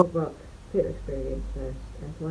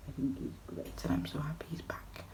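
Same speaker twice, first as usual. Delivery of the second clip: whispering; 10 dB quieter than usual. Real, not acted. Gushing aside about actor.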